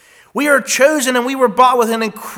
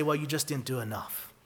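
much softer, lower murmured reproach; hushed mock rebuke; 10 semitones lower, 18 dB quieter than usual. Preacher voicing what God won't say, a much softer low murmur. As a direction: drop it low, like a rebuke you'll never hear. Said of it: "God's not gonna say".

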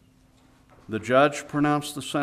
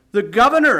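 loud, high cry of disbelief; shocked exclamation; 9 semitones higher, 10 dB louder than usual. Acted. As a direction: blurt it out, stunned. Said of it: "exclaimed the lad".

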